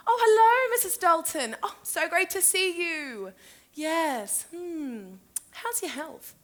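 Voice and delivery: high, drawn-out, effusive hello; sugary sing-song greeting; gushing greeting. Acted. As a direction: gush sweetly over an elderly friend. Gushing greeting to elderly friend.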